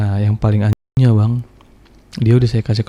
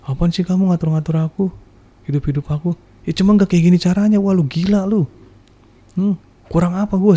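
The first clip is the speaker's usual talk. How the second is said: higher, mock-indignant girlfriend voice; girlfriend's defiant retort; 8 semitones higher than usual. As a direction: voice her huffy comeback. (meniru suara pacar yang ngeles dengan nada tinggi)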